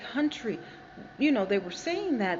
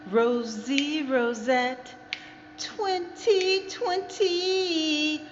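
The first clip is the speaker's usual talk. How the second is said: drawn-out announcer; 6 semitones higher, much slower than usual. Acted. Repeats sign-on testing echo.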